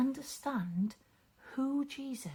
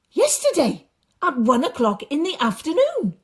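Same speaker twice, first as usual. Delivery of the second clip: higher, louder cheerful answer; servants' answer; 5 semitones higher, 12 dB louder than usual. Acted. Servants telling the father when the fever left, glad. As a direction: tell him plainly and gladly. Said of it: "the men replied".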